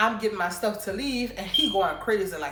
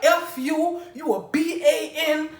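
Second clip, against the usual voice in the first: cussing him out; 8 semitones higher, 5 dB louder, slower than usual. Retold or imitated. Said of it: "all kind of stuff going off on him".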